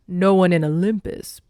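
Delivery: loud, imposing god voice; big commanding character voice; Poseidon. A narrator's reading. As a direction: boom it out with authority. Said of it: "Poseidon said".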